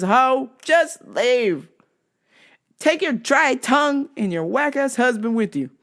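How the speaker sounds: high mock-fed-up sass; sassy dismissal. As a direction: send her away with attitude. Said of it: "I was like".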